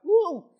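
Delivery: high, loud "woo!"; mock swooning whoop. Imagined wife admiring her grumpy husband, mock swooning whoop. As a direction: let out a sudden high whoop of pretend delight.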